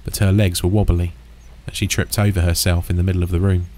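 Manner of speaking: ordinary delivery, nothing marked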